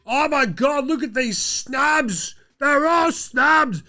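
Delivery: high, slow hammed-up outrage; mock outraged critics. Host mocks people crying snubs, high exaggerated outrage. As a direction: wail it high, mocking the complainers. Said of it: "And they're like".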